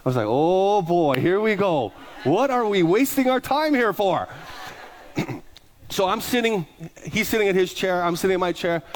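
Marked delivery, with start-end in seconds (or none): 0.3-4.3 exasperated groan. Retold or imitated. Inner groan at rude answer.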